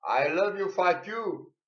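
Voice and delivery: high, loud concert yell; a fan shouting from the crowd; mock fan yell. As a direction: holler it like a fan at a gig. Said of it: "urlă ăia din concertile".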